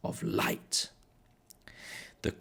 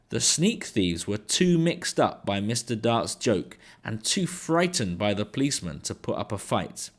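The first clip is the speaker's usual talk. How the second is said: usual speaking voice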